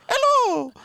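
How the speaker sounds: very high, loud cartoon Italian greeting; hammed-up falsetto Italian impression; Mario. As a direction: squeal it out like an Italian game hero. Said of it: "like, Italian guy".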